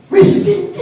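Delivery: high, loud single word; emphatic. Real, not acted. Preacher to young people, stressing Miskin high and loud.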